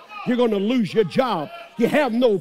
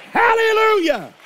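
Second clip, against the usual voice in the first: high, loud praise shout; ecstatic hallelujah; 12 semitones higher, 6 dB louder than usual. Real, not acted. Preacher erupting in praise, high and loud. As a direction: cry out in rapture.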